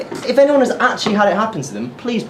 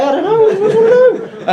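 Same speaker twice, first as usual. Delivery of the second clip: very high squeaky helplessness; hammed-up fluster, voice leaping up; flustered panic; 4 dB louder, slower than usual. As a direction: squeal it, lost for an answer. Flustered reply to odd question.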